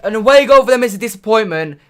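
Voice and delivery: a fan celebrating once the goal is given; relieved celebration. Real, not acted. Goal stands after offside check.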